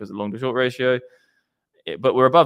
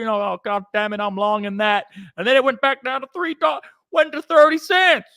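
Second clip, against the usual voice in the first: hammed-up Southern farmer tale, climbing ever higher; southern accent; 6 semitones higher, 3 dB louder than usual. Trader spinning a tale about corn prices, southern accent building to outrage. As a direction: build from Southern storytelling to high-pitched Southern outrage. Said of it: "your accent went straight southern".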